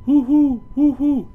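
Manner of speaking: high, loud hoo like an owl; owl hooting. Pretend. Reading to kids, hooting high like an owl. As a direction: hoot it out like an owl.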